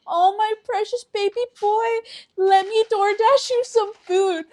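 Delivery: put-on high, loud gushing baby talk; doting mom. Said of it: "my mom's gonna be like".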